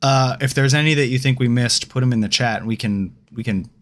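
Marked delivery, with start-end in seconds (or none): none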